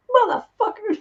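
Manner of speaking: high-pitched laughing curse; laughing retold insult. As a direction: imitate the insult through a laugh.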